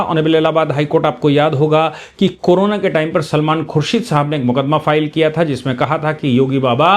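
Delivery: normal talking voice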